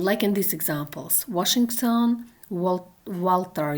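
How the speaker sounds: ordinary talk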